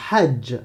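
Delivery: "hajj" opened with a rough, strangled throat breath; Arabic ḥ sound. Shown as a demonstration. Teacher modelling "hajj" with a raspy throat h. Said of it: "Comme dans".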